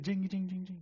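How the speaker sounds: high imitated bell, rapid repeats; door bell jingle. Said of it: "the door just jing".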